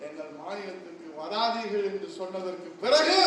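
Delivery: normal talking voice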